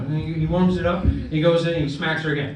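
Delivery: normal talking voice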